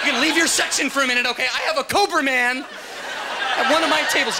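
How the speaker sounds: high, urgent alarmed shout; panicked waiter. Acted. Waiter begging kitchen staff for help with Cobra Man, panicked. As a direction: raise the alarm, high and comically frantic.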